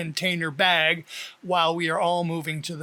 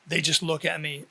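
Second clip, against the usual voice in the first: usual speaking voice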